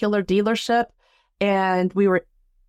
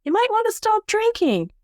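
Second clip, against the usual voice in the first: little inner voice; 12 semitones higher than usual. Retold or imitated. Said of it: "this little voice inside my head says".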